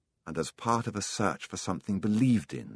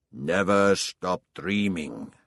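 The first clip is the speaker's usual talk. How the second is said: put-on slow, drawn-out words of an old king; stately old king voice, remembered; 3 dB louder, slower than usual. A narrator's reading. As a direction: intone it slowly as the old king's counsel. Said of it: "the old king had said".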